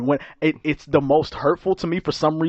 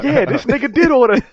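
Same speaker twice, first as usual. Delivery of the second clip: mock agreeing voice; 12 semitones higher, 8 dB louder than usual. Mock agreement, high put-on voice.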